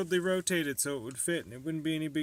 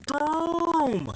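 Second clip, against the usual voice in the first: high, loud shouted Boom; 14 semitones higher, 9 dB louder than usual. Acted. Maker shouting at viewers for effect, loud boom. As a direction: punch it out like an explosion.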